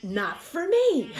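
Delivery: slow, high laugh through the words. Real, not acted. Admitting Zumba isn't for her, laughing loudly. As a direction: laugh it off at yourself.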